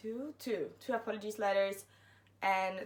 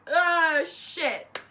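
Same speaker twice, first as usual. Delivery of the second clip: alarmed curse; 7 semitones higher, 9 dB louder than usual. Retold or imitated. Realising the rice is burning.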